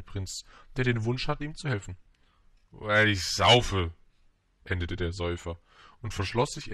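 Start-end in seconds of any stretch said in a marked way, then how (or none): 2.7-3.9 loud, drawn-out drinker voice; drunkard's outburst. A narrator's reading. Drinker ends his confession to the prince, loud slow outburst. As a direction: blurt it out slowly and heavily.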